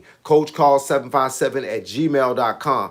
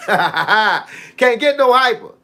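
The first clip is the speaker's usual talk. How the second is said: slow, laughing, high; 8 semitones higher, much slower than usual. Real, not acted. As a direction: laugh through the words. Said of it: "Don't y'all think I'm hype enough?".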